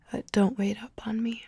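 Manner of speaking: ordinary talk, nothing marked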